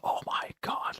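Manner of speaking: hushed, breathless disbelief; stunned whisper. Retold or imitated. Retelling friend's stunned reaction, whispered. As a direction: whisper it like you can't believe it. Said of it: "he just goes ballistic".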